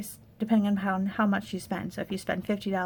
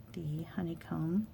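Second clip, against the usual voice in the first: soft whisper; 2 semitones lower, 7 dB quieter than usual. Real, not acted. Hushed while placing strips.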